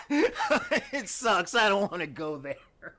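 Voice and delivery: high, loud protest through laughter; laughing protest. Acted. Comic as kids refusing to go to space, loudly laughing.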